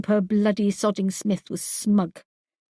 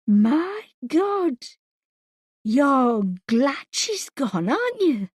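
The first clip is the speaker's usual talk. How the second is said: horrified realization; 4 semitones higher, slower than usual. A narrator's reading. Wife realizes dark truth.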